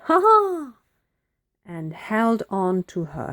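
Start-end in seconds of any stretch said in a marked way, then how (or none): 0.1-0.8 loud, high "ha, ha!"; witch laughing aloud. A narrator's reading. Witch enjoys the windy flight, laughing aloud. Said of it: "The witch laughed aloud".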